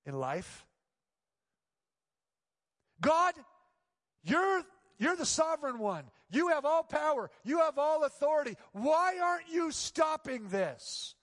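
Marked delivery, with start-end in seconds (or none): 3.0-11.2 loud, high pleading shout that slows at the end; angry cry to God. Retold or imitated. Alone in his apartment, pastor shouts at God in anger. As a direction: demand answers from heaven. Reenacted prayer of protest to God.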